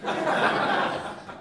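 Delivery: high breathy burst of laughter. Real, not acted. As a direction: laugh it off, giggling. Laughs after okay.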